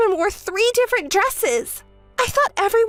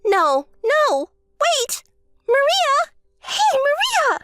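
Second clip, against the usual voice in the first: high, pleading cries after her; desperate calling out; slower than usual. Acted. Sister begging Maria to stay, high and pleading. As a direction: call her back before she hangs up.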